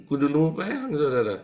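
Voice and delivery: a preacher's sudden outcry; sudden loud exclamation. Real, not acted. (seruan keras tiba-tiba)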